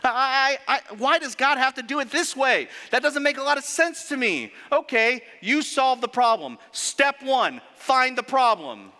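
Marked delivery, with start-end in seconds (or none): 0.0-4.5 higher, whining know-it-all doubting God; mock whiny complainer. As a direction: pretend to grumble like a petulant critic, voice pushed up. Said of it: "we think sometimes".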